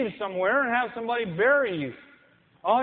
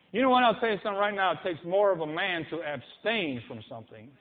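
ordinary talk, nothing marked

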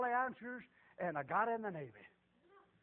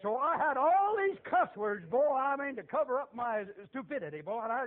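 loud, high, driving preacher's cry; preaching at full force; 6 semitones higher, 7 dB louder than usual. Real, not acted. Preacher to his congregation, loud driving preaching. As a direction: hammer it home to the congregation.

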